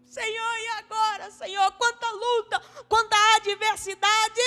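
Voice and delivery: high pleading lament; lamenting Joshua. Retold or imitated. Preacher imagining Joshua's high lament to God. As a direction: whine to God in despair. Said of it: "se eu fosse o Josué".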